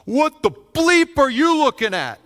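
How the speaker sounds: loud, high, slow angry yell; retold yell. Driver yelling out his window at a stranger, loud and slow. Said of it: "and he yells at me out the window".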